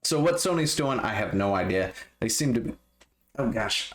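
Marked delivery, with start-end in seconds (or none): 2.2-3.9 interrupted by a hiccup. Real, not acted. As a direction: hiccup mid-sentence. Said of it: "I got the hiccups suddenly".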